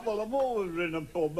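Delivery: normal talking voice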